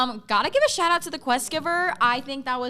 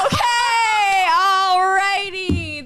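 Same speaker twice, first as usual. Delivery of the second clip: flustered laugh; 7 semitones higher, 5 dB louder, much slower than usual. Real, not acted. Flustered on going live.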